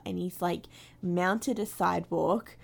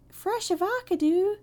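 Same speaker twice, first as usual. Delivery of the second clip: vine quote; 11 semitones higher, 5 dB louder than usual. Retold or imitated. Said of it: "classic vines".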